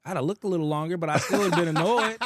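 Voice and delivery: talked through real laughter; laughing. Real, not acted. Admitting to his friend he'd look longer, laughing. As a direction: laugh while you confess it.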